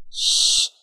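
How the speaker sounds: sharp hushing sound; threatening shush. A narrator's reading. Mugger holding a knife to her neck, threatening shush. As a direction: hush the victim into silence.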